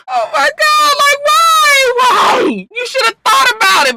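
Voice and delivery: high, loud crying out in disbelief; real outrage, high-pitched and loud; outraged wail. Real, not acted. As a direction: wail at the screen. Outraged at TV character.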